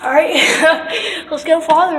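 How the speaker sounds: ordinary delivery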